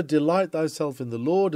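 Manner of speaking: ordinary talk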